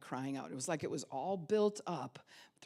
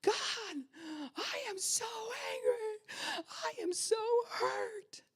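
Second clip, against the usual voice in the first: much higher, raw, pleading cry; reliving a broken outburst in prayer, much higher; anguished cry to God; slower than usual. Retold or imitated. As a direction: cry out to God from the floor, angry and hurt. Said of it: "just being like".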